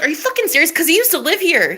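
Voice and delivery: high-pitched mock outrage; heated, retold protest; imitating heated man. Woman quoting her pissed-off protector, mock heated. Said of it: "he was getting heated. He was pissed".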